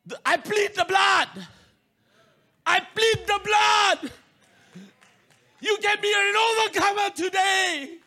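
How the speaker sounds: high, loud shouted proclamation; full-force preaching. Acted. Preacher shouting the climax to the congregation, full force. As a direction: thunder it to the congregation.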